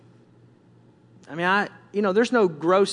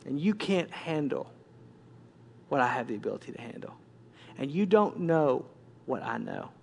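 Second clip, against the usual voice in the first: soft, low admonishment; hushed authority, pausing between phrases; stern, still small voice; 3 semitones lower, 6 dB quieter than usual. Acted. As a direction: lay down the terms quietly and firmly. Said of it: "very sternly just speak to my heart".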